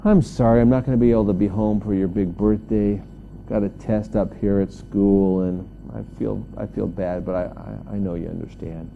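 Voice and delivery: low, softening apology to mom; sheepish, low-voiced regret on the phone; apologetic son. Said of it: "called home from school ... and said".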